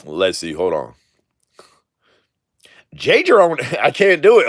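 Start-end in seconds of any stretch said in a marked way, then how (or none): 3.0-3.6 lightly voiced Poindexter, higher. Shown as a demonstration. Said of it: "I straight sounded like Poindexter". 3.7-4.5 higher, laughing as he gives up; real laugh running into the words. Real, not acted. Cracks up at failed old voice.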